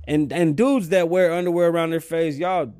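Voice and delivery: loud disgusted rant. Acted. Ranting about masks.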